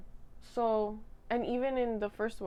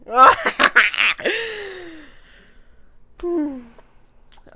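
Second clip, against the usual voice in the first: loud, high laughing fit; giddy laughter; 7 semitones higher, 11 dB louder than usual. Real, not acted. Swooning over the Spider-Man kiss, loud laughing fit. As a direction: laugh helplessly, flustered. After quoting the kiss line.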